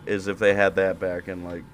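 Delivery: ordinary delivery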